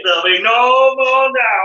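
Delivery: loud, drawn-out mock telling-off; robust anger. Brit confronting a queue-jumper, robust mock anger. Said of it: "angry, robust".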